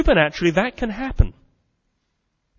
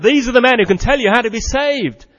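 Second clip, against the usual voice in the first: crying out; 9 semitones higher, 8 dB louder than usual. Acted. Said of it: "that crazed woman crying out".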